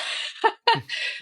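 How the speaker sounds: breathy high burst of laughter; real laugh. Real, not acted. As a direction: laugh at how absurd it was. Laughing at doctor's remark.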